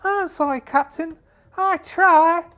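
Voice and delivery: very high, apologetic voice; sheepish young lad. Acted. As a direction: squeak out the apology.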